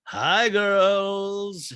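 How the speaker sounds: high, loud, dopey stoned hello; a hint of stoned husband; stoned greeting. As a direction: greet them like you're already high. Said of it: "He's already stoned".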